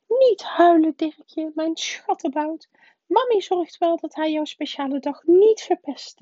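doting mother. A narrator's reading. Mother comforts her fake-crying son, doting. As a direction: coo and soothe in a high voice. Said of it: "riep ze".

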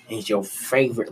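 ordinary delivery

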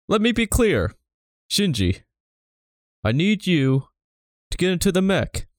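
measured Obama cadence with high lifts; Obama impression. Gendo as Obama asking Shinji, measured presidential cadence. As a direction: pause between phrases like Obama at a podium. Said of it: "Gendo as Barack Obama".

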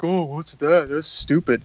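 lightly voiced mocking friend; a hint of a scoffing buddy; mock the friend who dismisses your cereal pick, barely shifted; imitating Jake. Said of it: "that was a great imitation of, uh, Jake".

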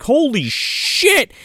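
high, very drawn-out exclamation; mock shock. Father running out to the wreck, slow mock shock. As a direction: stretch it out in mock disbelief. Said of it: "runs out and he's like".

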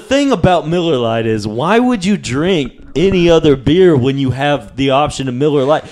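hammed-up beer ad pitch; mock ad read. Hosts jokingly plugging their sponsor, mock ad read. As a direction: sell it like a beer commercial.

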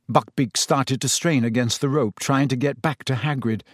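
ordinary delivery, nothing marked

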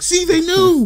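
high, loud conspiracy shouting; mock Twitter outrage. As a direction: imitate a hysterical online crowd. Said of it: "people on Twitter being like".

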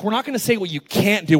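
ordinary talk

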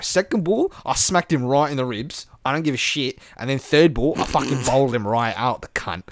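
boastful mock Aussie bowler; imitated sweary Aussie cricketer; Australian accent. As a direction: recount it like a cocky Australian. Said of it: "everything you expect from an Aussie".

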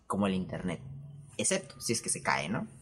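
usual speaking voice

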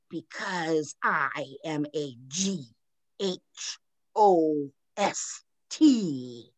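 slow, drawn-out ghost voice spelling each letter. Retold or imitated. Retelling the ghost's answer to Gross, slow and eerie. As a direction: spell it out slowly like a spirit from beyond. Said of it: "And then the voice goes".

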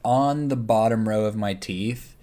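usual speaking voice

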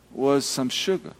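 ordinary talk

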